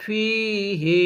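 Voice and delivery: ফিহি with its last vowel held long; টান দিয়ে ফিহি. Shown as a demonstration. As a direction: draw out ফিহি very slowly. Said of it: "এখানেও কিন্তু টানের অপশনটা আছে".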